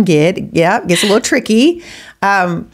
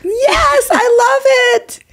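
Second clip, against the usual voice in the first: high, slow, stretched-out delight; delighted squeal; much slower than usual. Real, not acted. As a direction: gush over it like a fan. Host delighted by the story.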